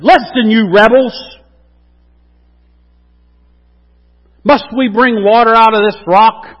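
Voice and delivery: loud, high, furious rebuke of the rebels; angry rebuke. Acted. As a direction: thunder at the grumbling crowd. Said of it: "he had a bad attitude".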